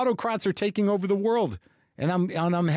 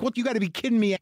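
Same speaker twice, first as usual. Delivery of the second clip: agitated disbelief; 6 semitones higher, much faster than usual. Retold or imitated. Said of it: "sweaty, pacing, like".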